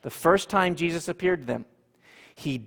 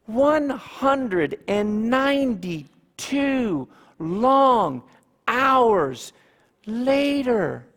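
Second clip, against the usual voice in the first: "One hundred and ninety-two long hours later" very slow and higher; drawn out; 5 semitones higher, much slower than usual. Shown as a demonstration. Preacher makes the congregation feel the wait, slowly. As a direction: stretch every word like the wait. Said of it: "stretches the time for effect".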